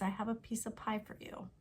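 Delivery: quick casual run with "of" cut to "uh"; reduced "of". Shown as a demonstration. Teacher demonstrating everyday reduced speech, "of" swallowed in a quick phrase. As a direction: slide "piece of pie" together, "of" unstressed. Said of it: "Think about how I'm saying".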